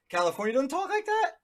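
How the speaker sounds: high, loud mock disbelief; mock surprise. Real, not acted. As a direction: squeal it in fake shock.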